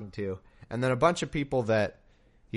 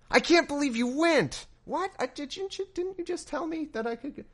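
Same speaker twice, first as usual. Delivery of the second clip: a sulky God. Retold or imitated. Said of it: "he's like".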